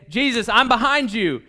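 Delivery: loud, keen declaration of support; mildly fired-up pledge of loyalty; worked up. Acted. Eager follower pledging support to Jesus, worked up. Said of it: "You get yourself all worked up".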